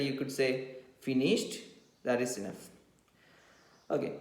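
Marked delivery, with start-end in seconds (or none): 1.0-1.6 one-word question, "Finished" rising at the end; questioning tune. Shown as a demonstration. Teacher briefly checks on pupils' note-taking, questioning tune. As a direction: lift "Finished" into a short question. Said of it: "your tune should reflect what you meant".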